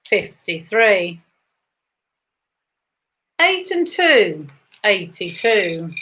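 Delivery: rising bingo number call; bingo caller. Acted.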